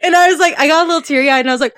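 usual speaking voice

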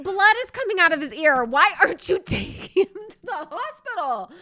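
high, loud disbelief cracking into laughter; mock outraged protest. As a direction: plead loudly with clueless parents. Outraged plea to negligent parents.

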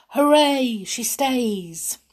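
loud, high storybook cheer. Acted. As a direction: cheer it out, easing on the end.

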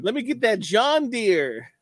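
high hammed-up farm girl; mock country girl. Mocking Jasmine's farm girl act, high and hammed-up. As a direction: gush like a country bumpkin.

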